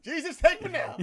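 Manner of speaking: high, laughing cry to heaven; mock despairing plea. Man retelling his reaction to ignorant remarks, mock despair. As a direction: beg for deliverance, played for laughs.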